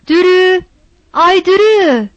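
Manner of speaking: loud high shout of the name; mother shouting for her missing daughter. A narrator's reading. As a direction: yell for her across the yard. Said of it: "diye bağırdı".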